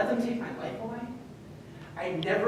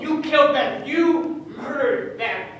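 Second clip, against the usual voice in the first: louder, higher, slow bitter charge; furious accusation; 4 semitones higher, 7 dB louder, slower than usual. Acted. Son accuses his father of murder, furious. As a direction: throw the crime in his face.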